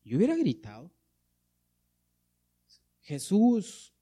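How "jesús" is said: calling out, higher pitched; mild imitated cry. Blind man calls out to Jesus, mild imitated cry. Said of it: "comenzó a dar voces".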